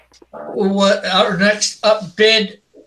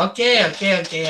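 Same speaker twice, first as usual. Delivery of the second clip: usual speaking voice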